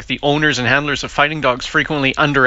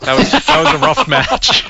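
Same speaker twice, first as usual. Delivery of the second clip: high, louder, breaking into laughter; laughing; 12 semitones higher, 5 dB louder than usual. Real, not acted. Host joking about the monkey's fight, laughing. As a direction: crack up midway through the line. Cracking up at own joke.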